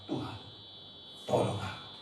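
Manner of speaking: soft, breathy prayer for help; a rejected woman quietly begging the Lord for help; hushed plea. Acted. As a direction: barely voice the plea to God.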